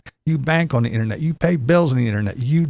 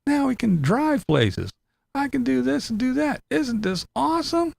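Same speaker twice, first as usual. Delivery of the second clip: mock excited; 12 semitones higher than usual. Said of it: "there was people like".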